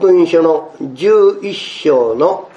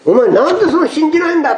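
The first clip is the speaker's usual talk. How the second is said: mock challenge; 12 semitones higher, faster than usual. Disciples confronting Thomas.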